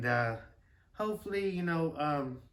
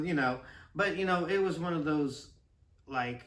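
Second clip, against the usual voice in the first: ordinary talk, nothing marked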